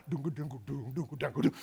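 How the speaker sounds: low, soft imitated drumming on dung; mock drum beats. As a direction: thump out the drum sounds quietly. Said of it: "Semuanya pakai gendang".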